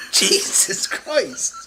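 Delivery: normal talking voice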